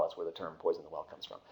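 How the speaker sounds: ordinary talk, nothing marked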